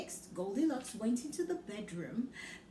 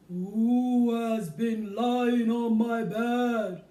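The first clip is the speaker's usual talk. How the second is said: big booming slow bear voice, much louder; very loud Father Bear; 10 dB louder, much slower than usual. Acted. Father Bear asks about porridge, booming loud slow. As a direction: boom it out slow like a huge bear. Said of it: "he asked in a very loud voice".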